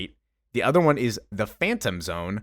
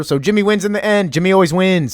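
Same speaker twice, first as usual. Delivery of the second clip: excited cheer; 9 semitones higher, 9 dB louder, faster than usual. Real, not acted. Cheering the story's happy ending.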